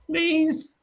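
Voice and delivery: very high-pitched plea; high, pleading voice; frightened villager. Acted. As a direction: squeak it out as a scared villager begging.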